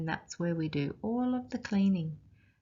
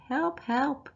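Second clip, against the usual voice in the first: higher, light hammed-up cry for help; higher mock cry for help; mock scream for help; 8 semitones higher than usual. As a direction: playfully call for Dad in a fright. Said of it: "I'm screaming for Dad".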